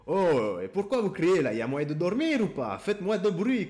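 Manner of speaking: put-on loud, old Italian-accented scolding. Father scolding a noisy neighbour at night, old Italian accent. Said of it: "avec son vieil accent italien".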